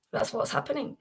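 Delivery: usual speaking voice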